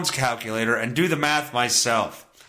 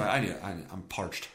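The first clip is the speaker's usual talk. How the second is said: normal talking voice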